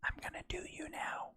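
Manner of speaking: mock sultry whisper in the ear. Role-player whispering a line into a lover's ear. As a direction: breathe it as a whisper. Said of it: "I whisper into your ear".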